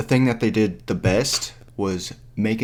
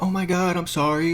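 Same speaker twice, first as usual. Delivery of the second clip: higher-pitched mocking apology; 8 semitones higher than usual. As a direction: imitate a self-centred friend saying sorry. Mocks the character's self-centred apology.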